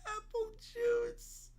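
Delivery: haunt them with a slow spectral wail; ghost of Caillou. Acted. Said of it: "The ghost of Caillou speaks!".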